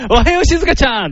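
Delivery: high bright Nobita voice greeting Shizuka; tanned Nobita. Pretend. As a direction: chirp it like a cartoon boy. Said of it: "こんがり焼けたのび太くん". (のび太風の高い声で挨拶)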